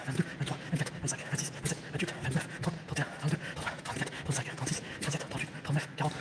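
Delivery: compter ses pas en chuchotant; compter à voix basse. Real, not acted. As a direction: murmurer les chiffres pour soi. (décompte chuchoté des pas)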